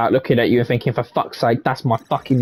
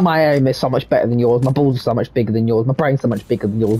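ordinary talk, nothing marked

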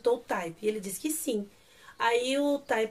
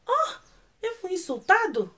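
high-pitched mock shock; offended realization; 8 semitones higher than usual. Pogum realizes he was insulted, mock offended. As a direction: act out a belated, wounded gasp.

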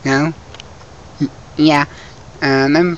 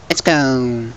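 ordinary talk, nothing marked

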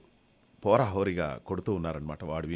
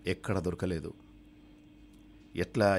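usual speaking voice

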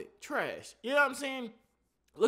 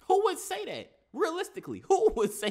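incredulous; 10 semitones higher, 6 dB louder than usual. Real, not acted. Incredulous rant.

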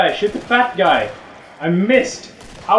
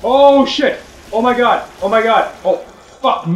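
high, loud alarmed outburst; panicked cries; 8 semitones higher, 5 dB louder than usual. Real, not acted. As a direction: cry out in sudden panic. Panicking as the player dies.

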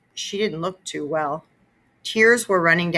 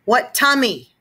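shouted; 6 semitones higher, 5 dB louder, slower than usual. A narrator's reading. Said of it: "I shouted at my brother".

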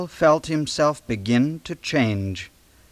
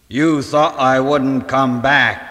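slow, louder menacing snarl; 5 dB louder than usual. Acted. Hyde snarling at Ivy, slow and loud. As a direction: snarl it like a returning monster. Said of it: "he snarled".

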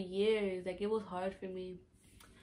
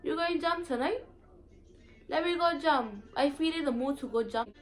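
higher, louder Virgin Islands party talk; Virgin Islands accent; 5 semitones higher, 4 dB louder than usual. Retold or imitated. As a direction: call out to a friend about the party, island style. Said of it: "They'd be like".

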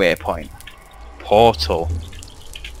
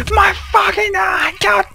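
high panicked yell; panicked shout. Real, not acted. Scared shout in the game.

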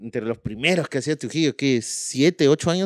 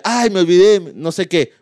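fake excuse; 5 semitones higher, 5 dB louder than usual.

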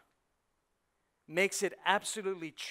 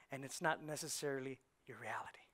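whisper; 5 semitones lower, 9 dB quieter than usual. Acted. Whispered line.

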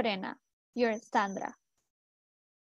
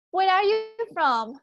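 louder and higher, a clear question for the class; teacher asking loudly; 6 semitones higher, 5 dB louder than usual. Shown as a demonstration. Teacher calls out the question loudly to her online students. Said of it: "¿De dónde eres tú?".

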